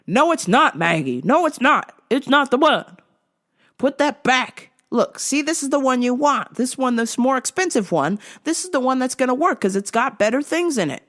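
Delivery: put-on high, loud bickering old man; husband voice. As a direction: scold your wife.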